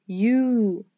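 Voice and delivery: U held long as "yoo"; long U sound. Shown as a demonstration. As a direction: draw U out into a long, clear "yoo". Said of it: "flute has the long U sound".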